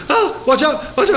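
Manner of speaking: high, fretting "oh, watch out"; mock fretting wife. Husband imitating his anxious wife in the car, high fret. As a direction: squeak it like a nervous wife. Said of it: "she's always going".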